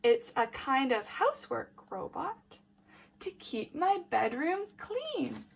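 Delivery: put-on lively read-aloud for small children; story reading. A narrator's reading. Teacher reading a picture book to the class, lively.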